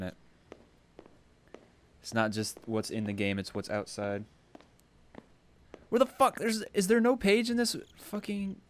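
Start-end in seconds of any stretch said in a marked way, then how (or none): 5.9-8.6 exasperated, higher-pitched rant; fed up and raising the pitch; real frustration. Real, not acted. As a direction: whine in disbelief at the missing page. Frustrated hunt for missing page.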